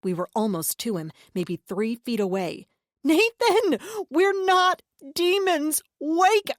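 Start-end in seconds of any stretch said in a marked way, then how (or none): 3.0-6.5 high, loud, slow shout; desperate shout. A narrator's reading. Sister shouting at her brother to wake up. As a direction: cry out to wake him. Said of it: "Anna shouted".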